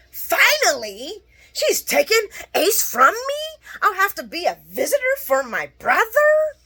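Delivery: high, loud mock yell; high-pitched outraged shout, half acted; mock scream. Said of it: "He starts screaming".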